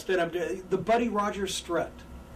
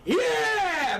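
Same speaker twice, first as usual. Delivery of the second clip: hammed-up babyface yell, pitched way up; high fired-up yell; 11 semitones higher than usual. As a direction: whip up the crowd with a big high yell. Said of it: "the worst thing you can do is go".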